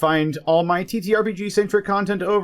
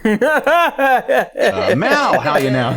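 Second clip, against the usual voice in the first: laughing; 6 semitones higher, 5 dB louder, much slower than usual. Real, not acted. Laughing greeting.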